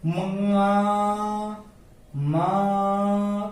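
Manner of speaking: मंगा, मा with the last आ drawn out very long; long-held आ sound at the end of मंगा and मा; stretched final vowel. Shown as a demonstration. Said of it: "last में आ निकल रहा है, वो लंबे समय तक जा रहा है".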